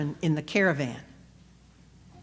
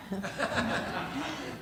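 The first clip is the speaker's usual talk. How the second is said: soft laugh; 13 dB quieter than usual. Real, not acted. Preacher laughs at own line.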